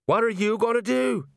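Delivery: panicked. Acted. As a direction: yell it out in panic. Colon panicking, dangling.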